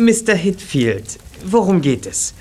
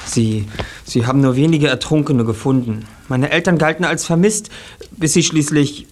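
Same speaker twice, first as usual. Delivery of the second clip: ordinary delivery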